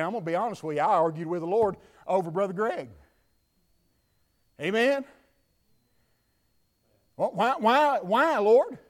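ordinary talk, nothing marked

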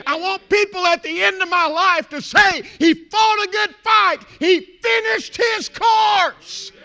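loud, high, ringing sermon climax; full-force preaching. Real, not acted. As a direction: preach it at a shout, stretching the key words.

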